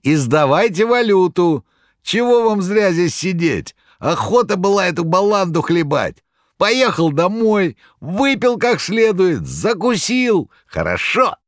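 high, loud hearty yell; loud cook's shout. A narrator's reading. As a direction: bellow it across the hall. Cooks shouting to audience.

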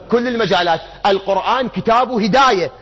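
ordinary talk

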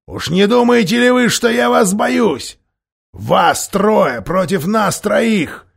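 loud, high, defiant challenge; defiant threat. A narrator's reading. Dwarf defies the king at the council, loud defiant threats.

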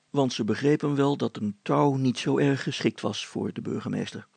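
ordinary delivery, nothing marked

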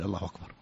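أكبر slurred onto الله as "Allahu-wakbar"; eased hamza. Shown as a demonstration. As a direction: let a w stand in for the hamza of أكبر. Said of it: "فلا، فلا يقول".

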